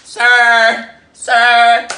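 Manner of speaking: hammed-up professional voice, high and loud. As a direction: stretch it out in exaggerated, high-pitched politeness. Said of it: "I had to put on my professional voice".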